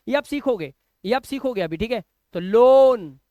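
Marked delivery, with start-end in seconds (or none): 2.4-3.2 लोन with one long, held 'oh' vowel; long O sound. Shown as a demonstration. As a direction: stretch the O in लोन, loud. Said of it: "ओ का sound".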